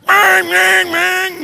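high, loud shouted bangs like a furious driver; mock angry horn shout. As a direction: hammer it out like a horn. Imitating angry driver honking.

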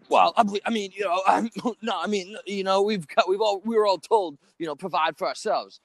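high, stammering mock excuse; imitating flustered man. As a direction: stumble through a defensive dodge. Imitates cornered man dodging rent question.

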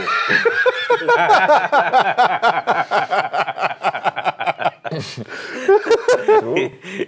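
high, loud laughing fit; cracking up for a long time; long burst of laughter. Real, not acted.

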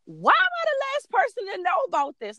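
high-pitched indignant outburst; worked-up mom yelling. Retold or imitated. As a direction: wail it like a hurt, exasperated mom. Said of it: "I'm not yelling because I'm upset".

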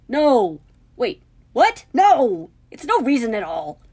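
loud, high, alarmed denial; flustered outburst. A narrator's reading. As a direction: burst out in disbelief. Harry flustered, refusing.

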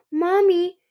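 put-on high, loud little-boy call; calling child. A narrator's reading. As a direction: call out for your mother. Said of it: "Cliff called".

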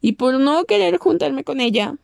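ordinary talk

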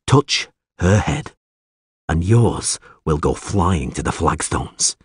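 slow, deliberate menace; measured threat. A narrator's reading. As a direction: spell out the threat slowly. Geralt repeats his threat.

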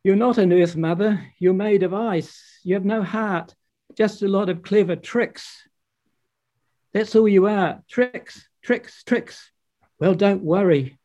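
angry outburst. Acted. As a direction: storm out with rage. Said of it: "With this outburst".

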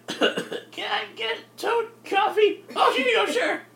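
very high, strained, slow squawk; cartoon Toad voice. Ordering toad coffee at the cafe, high put-on squawk.

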